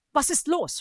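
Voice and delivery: high-pitched, nervous question. A narrator's reading. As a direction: ask anxiously, voice jumping up. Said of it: "fragte Arya nervös".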